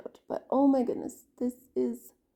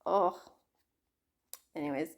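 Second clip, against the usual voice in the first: usual speaking voice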